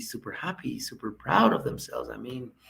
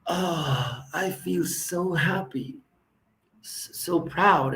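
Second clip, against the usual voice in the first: mock rapture; 6 semitones higher, 4 dB louder, much slower than usual. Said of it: "I say".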